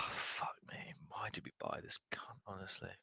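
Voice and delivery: breathy exasperated whisper, almost silent; frustrated whisper. Real, not acted. Fan whispering in disgust at his striker, muttered whisper. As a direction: sigh it out under your breath in disgust. Goal chance missed, frustrated.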